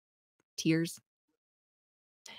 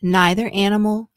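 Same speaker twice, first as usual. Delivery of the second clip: normal talking voice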